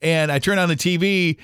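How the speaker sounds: ordinary delivery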